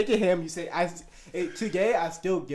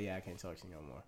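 fast and much softer, tossed off; quick soft dismissal; 11 semitones lower, 21 dB quieter, faster than usual. Retold or imitated. Quietly cutting off the crush, fast and soft. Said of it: "So I was just like".

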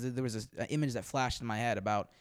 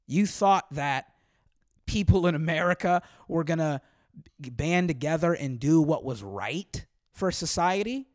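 angry rant; 3 semitones higher, 7 dB louder than usual. Real, not acted. Ranting at viewers.

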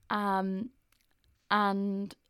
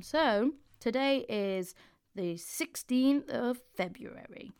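announcer voice; 3 semitones higher, slower than usual. Acted. Announcing horoscope date.

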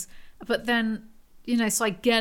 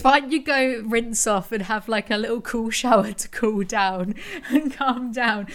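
imitating boyfriend; 3 dB louder than usual. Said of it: "His response to lots of things".

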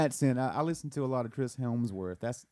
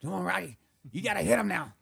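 higher, urging corner-man voice; Rocky's trainer; 6 semitones higher than usual. Acted. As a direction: push the fighter from his corner.

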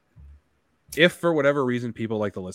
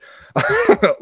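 loud laugh; 11 dB louder than usual. Real, not acted. Laughs at velvety voice compliment.

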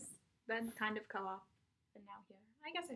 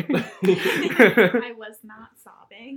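laughing denial; 8 dB louder, much slower than usual. Acted. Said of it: "teasing, acting out denial".

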